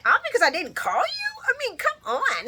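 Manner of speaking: incredulous protest. Retold or imitated. Voicing Carly's protest to Drew, high and incredulous. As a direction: imitate her exasperated, high-voiced comeback.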